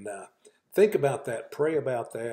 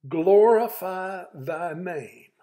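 loud, slow proclamation of glorify thy name, glorify drawn out and falling; proclaimed prayer; 6 semitones higher, 8 dB louder, much slower than usual. Acted. Preacher voicing Jesus to his online flock, slow loud proclamation.

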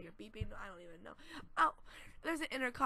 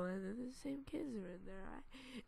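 usual speaking voice

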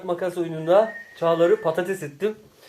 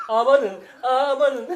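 excited alarm; 5 semitones higher, 6 dB louder, much slower than usual. Real, not acted. Reacting to a close game.

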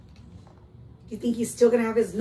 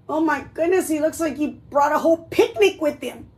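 ordinary delivery

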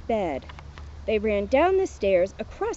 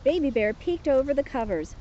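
ordinary delivery, nothing marked

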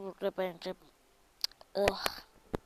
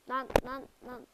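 ordinary talk

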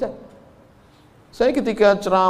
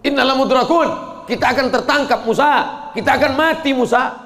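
panicked cry; 7 semitones higher than usual. Acted. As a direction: cry out in fear of being caught. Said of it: "Apa kata Bani Israel".

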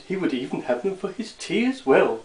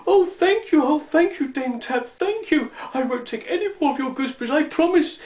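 high, gushing little gnome voice; excited; 8 semitones higher than usual. A narrator's reading. Cured gnome thanking Dame Tab, excited and high-pitched. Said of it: "he said excitedly".

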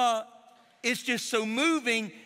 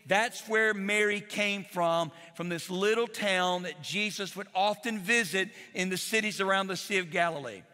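ordinary talk

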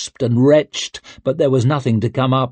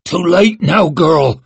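cold cruel voice; 6 semitones higher, 5 dB louder than usual. Acted. Torturer refuses her plea.

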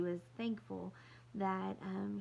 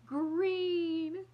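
much higher, much louder wail; 10 semitones higher, 9 dB louder than usual. Acted. Little girl wailing at the green shoes, high and loud. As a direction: wail the word in horror. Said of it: "Kirsty wailed".

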